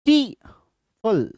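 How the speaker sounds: "टी" and "फुल" split by a pause; syllable by syllable. Shown as a demonstration. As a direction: say "टी", stop, then "फुल". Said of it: "जितनी बार रुको वही समझ लो". ("टी" और "फुल" अलग-अलग, रुककर)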